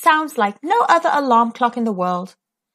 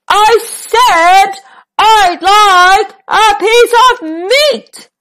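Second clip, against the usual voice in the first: slow, loud, higher roar; 9 semitones higher, 7 dB louder, slower than usual. Acted. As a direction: roar every word out slowly. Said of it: "roared Mister Noisy".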